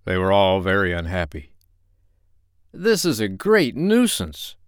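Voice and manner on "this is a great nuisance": higher, slow fretful doctor. Acted. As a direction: sound mildly put out. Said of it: "said the doctor".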